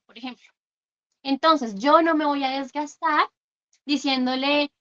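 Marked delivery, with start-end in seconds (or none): none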